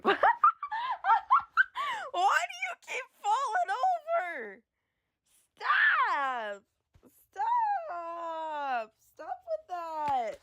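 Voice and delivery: high, squeaky wail; drawn-out high whine; voice gone high. Real, not acted. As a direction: plead in a voice that has jumped way up. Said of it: "what happened to my voice".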